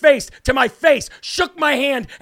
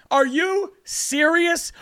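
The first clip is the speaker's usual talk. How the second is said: mock disbelief; 4 semitones higher, much slower than usual. Real, not acted. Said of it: "I just think it's funny".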